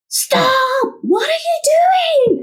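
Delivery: high, slow, strained shout; inner scream. Retold or imitated. Screaming at herself while paying, high and desperate. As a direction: yell at yourself in panic. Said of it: "I was screaming at myself".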